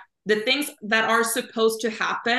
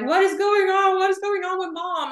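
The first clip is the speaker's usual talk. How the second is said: put-on confused child; 10 semitones higher than usual. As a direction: play a startled child calling out, much higher. Children confused by upset mother.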